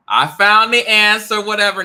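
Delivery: high, loud gloating cry; triumphant shout. Acted. Gloating at the neighbor in a high, loud shout. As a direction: crow it out like a win.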